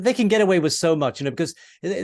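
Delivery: ordinary talk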